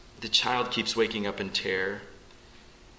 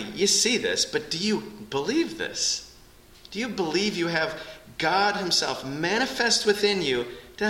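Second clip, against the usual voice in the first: fervent preaching; 8 semitones higher than usual. Acted. Pastor pleading with his congregation, preaching at full force. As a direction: press every word on the congregation.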